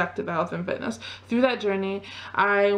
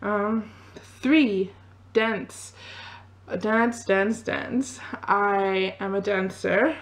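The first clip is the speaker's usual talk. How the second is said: ordinary talk, nothing marked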